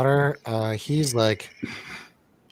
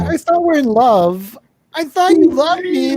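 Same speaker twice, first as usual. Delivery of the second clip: hammed-up pleading, much higher and louder; mock heartbroken; 14 semitones higher, 6 dB louder than usual. Voicing the jilted woman for laughs, hammed-up high pleading. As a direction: wail it like a melodramatic lover.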